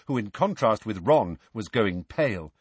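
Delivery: plain reading voice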